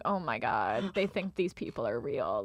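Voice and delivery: a hushed "oh my God" of disbelief; stunned aside. Retold or imitated. Retelling her appalled reaction to the TV room, low and soft. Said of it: "And I was like".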